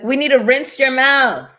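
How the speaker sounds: high, loud scolding mom voice; mother scolding her cursing daughter, high and loud. Retold or imitated. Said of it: "I can hear her now".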